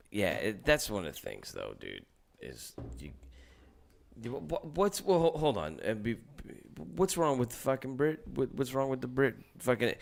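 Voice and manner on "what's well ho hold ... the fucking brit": usual speaking voice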